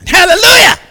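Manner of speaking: high loud praise shout; shouted hallelujah. Real, not acted. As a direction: cry out in praise. Preacher's exclamation.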